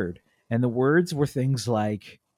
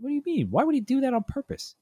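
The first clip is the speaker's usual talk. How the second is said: much higher, baffled disbelief; incredulous; faster than usual. Retold or imitated. Dad baffled by his wife's theory, high incredulous. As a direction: squeak out the question in disbelief. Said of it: "I'm like".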